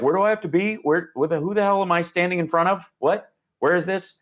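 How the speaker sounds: mock confusion, as if lost between gigs; dazed touring rock star.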